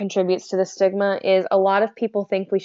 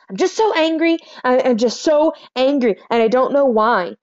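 higher, emphatic venting, leaning on "so"; retold angry outburst; 4 semitones higher, 4 dB louder than usual. Manic patient venting to the medical student, retold anger. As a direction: re-enact exasperated anger. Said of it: "I was so angry".

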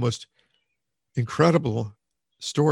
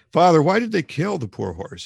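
high, loud anguished plea; narrated childlike distressed cry; acted sobbing child; 8 semitones higher, 8 dB louder than usual. A narrator's reading. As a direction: wail the question to a father. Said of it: "he sobbed".

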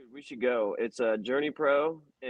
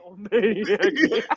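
laughing; 10 semitones higher, 4 dB louder than usual. Pretend. Echoing wrestler name in awe.

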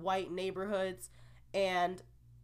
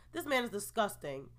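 normal talking voice

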